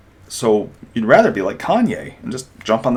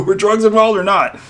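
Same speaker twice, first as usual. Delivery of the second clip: louder, higher, insistent; frustrated demand; 12 semitones higher, 7 dB louder than usual. Retold or imitated. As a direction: demand a straight answer.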